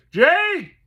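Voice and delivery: high, loud desperate holler; boy hollering after his horse. Acted. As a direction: call the horse back at full voice. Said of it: "Toby hollered".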